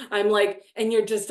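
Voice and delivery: usual speaking voice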